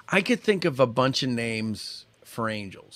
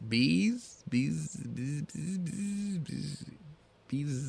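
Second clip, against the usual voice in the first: fading echo; 13 dB quieter, much slower than usual. Pretend. Repeats the hint, fading away.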